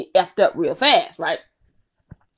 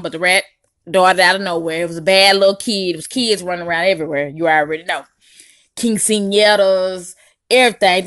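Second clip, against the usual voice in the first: ordinary talk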